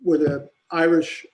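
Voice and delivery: normal talking voice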